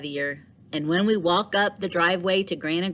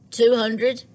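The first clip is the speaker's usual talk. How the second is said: higher, louder disbelieving ask; surprised question; 6 semitones higher, 4 dB louder than usual. Acted. Liam surprised at price.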